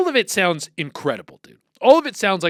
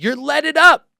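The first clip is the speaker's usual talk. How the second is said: higher, louder taunt; loud mocking jab; 9 semitones higher, 8 dB louder than usual. Real, not acted. Host taunting Cincinnati listeners, loud mocking jab. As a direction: jeer it at them.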